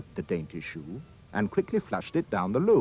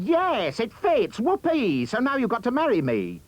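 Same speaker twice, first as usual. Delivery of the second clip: high, loud gloating scream; 12 semitones higher, 4 dB louder than usual. A narrator's reading. Ugly sister shrieks at the prince in triumph, high loud. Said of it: "she screamed".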